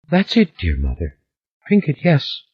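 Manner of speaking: soft, weepy coaxing; a light touch of crying; tearful girl. A narrator's reading. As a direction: coax through tears, hushed. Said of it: "the tears splattered".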